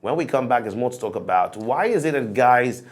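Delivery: upbeat presenter voice, steady and clear; show host break announcement. Acted. Host to viewers before the break, presenter voice. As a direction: tease the next topic like a TV host.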